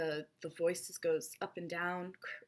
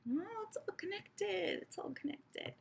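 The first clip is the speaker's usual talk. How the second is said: mystical whisper; 11 semitones higher, 7 dB quieter than usual. Shown as a demonstration. Mock revelation.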